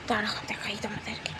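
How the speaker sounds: hushed whisper to the viewers. Real, not acted. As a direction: whisper so the birds stay calm. Whispering to viewers near birds.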